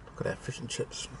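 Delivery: whispered, mouth full. Real, not acted. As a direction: breathe the words, no voice. Showing food while eating.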